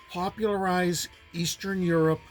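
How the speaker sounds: usual speaking voice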